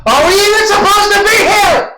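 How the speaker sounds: high-pitched call into the empty town; high thaumaturgy holler; magically amplified yell. Pretend. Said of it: "So, I do thaumaturgy again".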